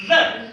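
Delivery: "the" said loud with tongue between teeth; model the. Shown as a demonstration. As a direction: stress "the", tongue out. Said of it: "it sounds like".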